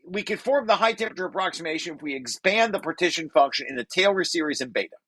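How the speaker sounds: ordinary talk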